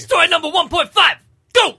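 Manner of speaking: loud high announcer intro; announcer shout. Acted. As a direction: announce it like a show host. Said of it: "can I intro each of them".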